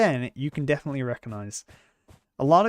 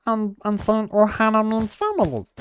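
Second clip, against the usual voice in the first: high, howled alien voice; Stitch voice; 8 semitones higher, 4 dB louder than usual. Shown as a demonstration. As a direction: pitch up into a howling Stitch. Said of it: "me howling my voice".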